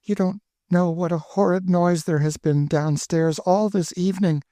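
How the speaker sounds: put-on high, pleading girlish voice; a frightened young girl begging. Narrator voices frightened Emmeline.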